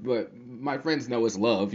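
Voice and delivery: usual speaking voice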